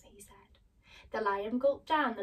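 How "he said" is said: narration tag whispered. A narrator's reading. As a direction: keep the whisper through the tag.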